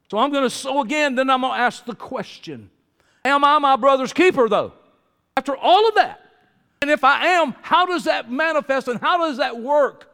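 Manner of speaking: loud, high preaching build; rising preaching. Acted. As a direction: lift the congregation with the question. Preacher building to full force.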